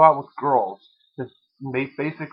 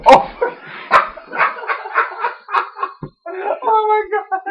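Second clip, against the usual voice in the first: high, loud, helpless laughing fit; screaming with laughter; shrieking laughter; 6 dB louder than usual. Real, not acted. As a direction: crack up at his fall. Boyfriend hit head on fan.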